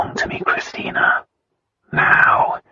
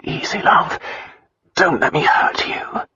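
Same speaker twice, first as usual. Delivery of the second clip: husky, strained whisper; tender restraint. A narrator's reading. Lion cautions his love, tender and breathy. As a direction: soothe her under the breath. Lover soothes her, whispering.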